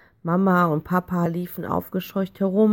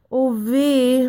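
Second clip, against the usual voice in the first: higher, drawn-out "oh dear"; helpless dismay; 5 semitones higher, 4 dB louder than usual. A narrator's reading. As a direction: lament as a mother at a loss. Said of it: "Mama sah ratlos aus". (ratlos klagend)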